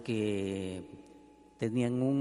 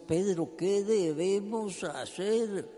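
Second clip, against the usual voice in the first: higher, desperate plea, slow on the question; pleading crowd; 8 semitones higher, 3 dB louder, slower than usual. Acted. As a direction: beg Pedro as a crowd convicted of sin. Weeping crowd begging Peter.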